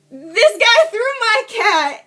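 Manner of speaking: high, loud, drawn-out outcry; re-enacted frantic report. Retelling her call to nine one one, frantic high outcry. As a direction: blurt it as an exaggerated emergency plea.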